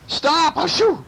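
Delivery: very high burglar cry; sneezy protest. A narrator's reading. Burglar shaken by clinging cats, sneezy protest.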